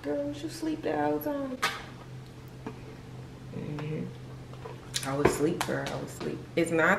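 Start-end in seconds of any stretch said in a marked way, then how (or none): 0.0-1.6 high, soft mock question; hushed, high-pitched put-on query; imitating puzzled viewer. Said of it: "If you looking at this like".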